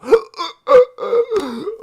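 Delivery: long, high, laughing gasp for air; imitated gasp. Streamer to chat, mimicking his own hiccup gasp. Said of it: "every five seconds you go".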